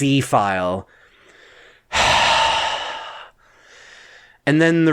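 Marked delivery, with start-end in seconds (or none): 1.9-3.1 long voiceless exhale; breathy sigh, no voice. Acted. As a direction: let all the breath out slowly. Wordless breath after running the file.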